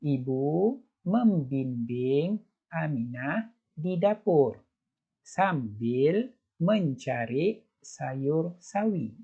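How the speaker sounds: slow, careful read-aloud; slow teacher read-aloud; slow model reading. Shown as a demonstration. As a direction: read it slowly so children can follow. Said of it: "baca lagi sekali".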